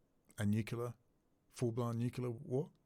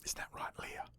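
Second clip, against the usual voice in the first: soft unvoiced whisper; hushed conspiratorial whisper; whispered aside; 10 dB quieter than usual. Real, not acted. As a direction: whisper it to the person beside you. Aside to someone off camera.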